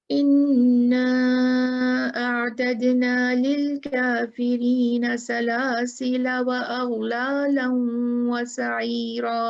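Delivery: slow, resonant tajweed recitation; reciting the verse for the class; Quranic recitation. Shown as a demonstration. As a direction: recite slowly with long held vowels. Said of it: "reciting verse example".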